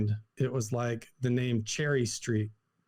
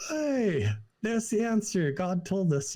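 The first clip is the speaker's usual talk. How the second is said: retold eureka, pitched much higher; excited realization; 7 semitones higher, slower than usual. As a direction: replay the thrill of finding the answer. Retold eureka moment after matching names.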